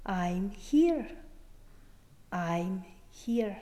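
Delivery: soft, low, gentle reassurance; hushed retelling of a whispered comfort, still voiced; retold whisper. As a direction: reassure quietly, voice dropped low. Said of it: "he whispered in my ear".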